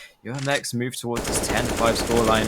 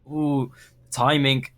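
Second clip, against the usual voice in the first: excited; 4 semitones higher, 7 dB louder than usual. Real, not acted. Live play-by-play of a clutch shot.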